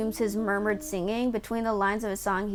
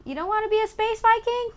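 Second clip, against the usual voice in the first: high, teasing question; 11 semitones higher than usual. Acted. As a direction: tease him like a child. Teasing the character.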